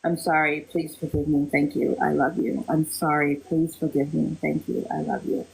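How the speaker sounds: quietly chanting to a loved one's picture; soft mantra. Shown as a demonstration. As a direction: say it gently to the photo. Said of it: "looking into the eyes of the photo".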